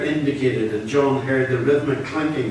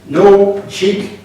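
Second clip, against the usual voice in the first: gunman's warning; 9 semitones higher, 11 dB louder than usual. Acted. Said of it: "said the gunman".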